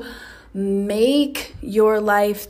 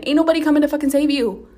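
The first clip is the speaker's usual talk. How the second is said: faster, higher and louder second time; louder repeat; 5 semitones higher, 6 dB louder, much faster than usual. Shown as a demonstration. Podcaster repeating her warning to listeners, louder and faster. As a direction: hammer it home, fast and loud. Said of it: "Let me say that again".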